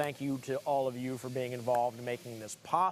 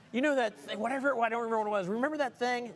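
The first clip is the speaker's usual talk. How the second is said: mock-frustrated, higher-pitched whine; fake frustration; 7 semitones higher, faster than usual. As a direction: put on frustration for the wife. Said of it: "I'm smiling the whole time".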